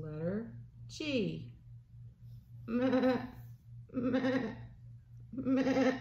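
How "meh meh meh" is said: bleating goat. Pretend. A parent bleating like a goat for a toddler. Goat noise in guessing game.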